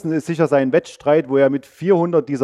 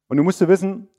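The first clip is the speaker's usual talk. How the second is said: usual speaking voice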